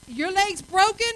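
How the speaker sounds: mock rude jab; sarcastic retort. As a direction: snap back at family. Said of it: "And you say".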